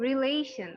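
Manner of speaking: রিলেশন said as rileshon, falling; model pronunciation. Shown as a demonstration. Teacher models রিলেশন to class, careful and clear. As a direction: say রিলেশন cleanly with -shon ending. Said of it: "উচ্চারণটা হবে রিলেশন".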